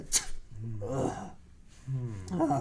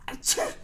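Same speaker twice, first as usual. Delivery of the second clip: sneeze. Pretend. Dad pretends to keep sneezing.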